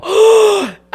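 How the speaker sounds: loud, high shocked gasp. Retold or imitated. Woman retelling seeing the diamonds, loud gasp. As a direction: suck in a sharp breath of surprise. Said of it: "I literally gasped".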